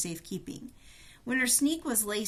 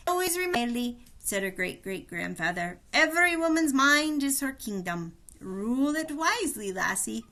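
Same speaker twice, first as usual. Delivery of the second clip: put-on higher, louder hearty old man giving advice; old Irish grandfather; 3 semitones higher, 4 dB louder than usual. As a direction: lecture her fondly like an old Irish grandad. Great-great-grandfather advises Haley.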